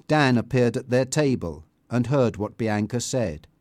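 plain reading voice

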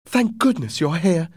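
high-pitched mother's relief; relieved mother. Acted. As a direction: greet the rescuer in a lifted, grateful voice. Rescued mother greets the policeman.

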